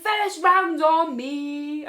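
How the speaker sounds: mock merry shout with long stretched words; drunken announcement. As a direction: bellow it slowly, imitating a drunk friend. Friend repeats her offer louder.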